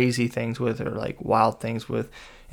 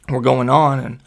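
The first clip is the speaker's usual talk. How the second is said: ordinary delivery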